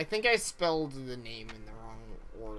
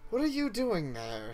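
lightly higher sweet princess voice; a hint of a fairy-tale princess; theme park princess; 7 semitones higher than usual. Princess voiced in read-aloud story.

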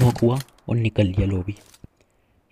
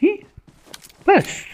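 sudden yelp; 4 dB louder, much slower than usual. Real, not acted. Sudden outburst.

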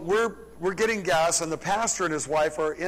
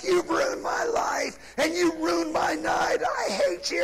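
high-pitched, drawn-out mock shriek; imitated screaming bride; 12 semitones higher than usual. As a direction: screech it like a bride screaming at her groom. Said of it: "they're yelling and screaming at each other".

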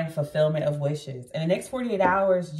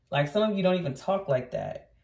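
usual speaking voice

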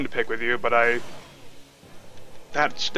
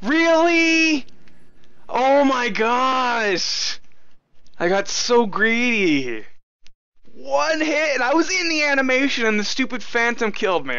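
high, drawn-out wail of disbelief; wailing at an infuriating game death; real exasperated outburst; 12 semitones higher, slower than usual. Real, not acted. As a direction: stretch each word out in high-pitched outrage. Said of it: "Oh, I'm so mad".

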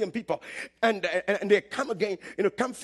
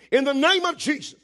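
commanding prayer; 7 semitones higher, 7 dB louder than usual. Retold or imitated. Grandmother commanding the dead chicken.

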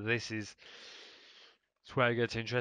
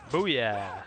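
triumphant shout; 7 dB louder than usual. Real, not acted. Triumphant shout after a kill.

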